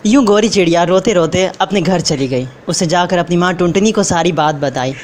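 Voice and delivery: ordinary delivery, nothing marked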